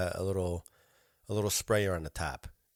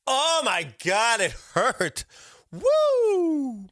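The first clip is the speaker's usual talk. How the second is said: loud, high cry over remembered pain; pained exclamation; 13 semitones higher, 5 dB louder, much slower than usual. Retold or imitated. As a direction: wail it, rising into a high whoop.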